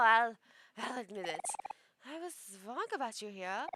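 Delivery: vain princess in a mock German accent; self-admiring villain, theatrical European accent; mixed European accent. Princess boasting then threatening the hero, mock German accent. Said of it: "we're gonna do a mix of both for her".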